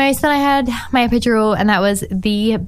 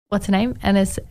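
ordinary talk, nothing marked

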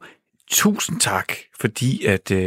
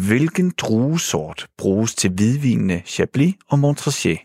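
normal talking voice

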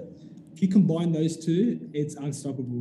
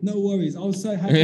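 ordinary talk

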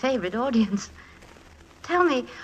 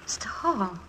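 whisper; 3 semitones lower, 9 dB quieter than usual. Acted. Moved actress whispers his name.

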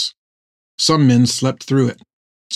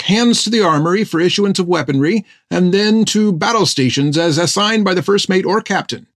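higher, rushing nervous recital; hurried nervous babble; 7 semitones higher than usual. A narrator's reading. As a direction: gabble it out in a hurry. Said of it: "as if he was in a great hurry to get his words out".

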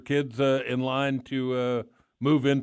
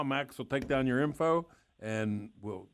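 ordinary talk

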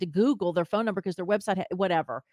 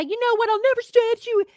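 much higher, louder hammed-up entitled customer rant; mock Karen; 14 semitones higher, 6 dB louder than usual. Mocking the complaining customer she refuses to be, shrill hammed-up rant. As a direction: play an outraged Karen making threats, overdone. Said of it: "I'm not trying to be a Karen".

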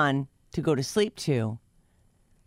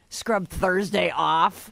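ordinary talk

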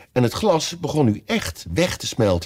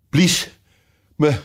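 gasped out higher, in broken bits with pauses; panting; 5 semitones higher, slower than usual. A narrator's reading. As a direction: pant it out, short of breath. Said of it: "hijgde de koningin".

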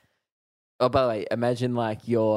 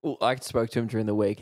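normal talking voice

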